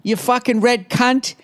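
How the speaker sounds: high-pitched, hammed-up English accent. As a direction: put on a silly high English voice. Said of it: "why she has an English accent".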